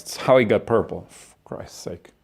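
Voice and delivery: ordinary talk, nothing marked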